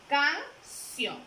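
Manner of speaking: "canción" dividida en «can» y «ción», con pausa clara; sílaba por sílaba. Shown as a demonstration. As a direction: separa "canción" en dos golpes de voz: can... ción. Teacher splits word into syllables. ("canción" silabeada, con una pausa entre «can» y «ción»)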